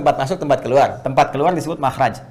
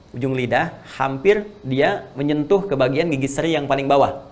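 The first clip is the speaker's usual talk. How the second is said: ordinary talk, nothing marked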